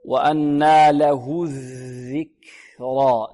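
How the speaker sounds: الذكرى with air released after the k; very slow chant, let breath escape on the ك of الذكرى; whispered-breath kaf. Shown as a demonstration. Teacher shows the kaf's breath, slow chant. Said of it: "الكاف مهموسة".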